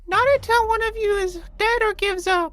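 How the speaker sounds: very high, loud creature voice; mushroom person. Acted. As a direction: squeak it out like a tiny mushroom. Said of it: "you hear the mushroom voice".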